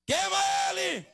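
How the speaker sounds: shouted deliverance command. Real, not acted. Preacher driving out a killer spirit, shouting. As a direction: cry it out with all your might.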